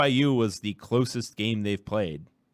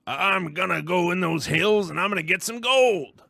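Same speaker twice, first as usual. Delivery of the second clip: gold prospector voice; 10 semitones higher, 6 dB louder than usual. Retold or imitated. Joke voice after Irish bit.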